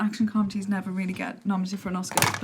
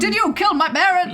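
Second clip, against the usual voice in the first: mock dramatic; 10 semitones higher, 8 dB louder than usual. Acts out film confrontation.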